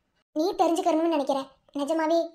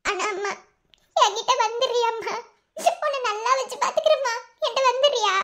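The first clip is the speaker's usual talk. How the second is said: sobbing plea; 6 semitones higher than usual. Acted. Daughter begs mother to return.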